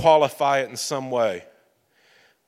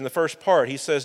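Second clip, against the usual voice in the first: ordinary talk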